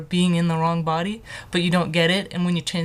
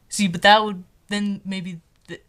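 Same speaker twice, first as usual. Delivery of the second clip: ordinary talk, nothing marked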